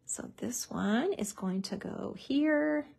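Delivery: soft, slow whispered check; slow whisper to self. Real, not acted. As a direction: whisper it slowly as you line it up. Placing piece, talking to self.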